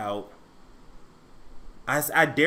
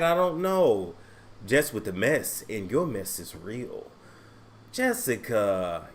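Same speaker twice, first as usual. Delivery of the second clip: ordinary delivery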